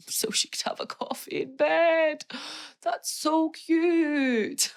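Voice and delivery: high, drawn-out cooing; gushing squeal. Real, not acted. Gushing over a man making coffee, high cooing.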